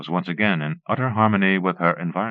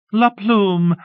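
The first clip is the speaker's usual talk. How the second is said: much higher, bright cheerful announcement; gaily announced; 13 semitones higher, 5 dB louder than usual. A narrator's reading. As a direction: sing it out like a proud tour guide. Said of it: "the Duchess announced gaily".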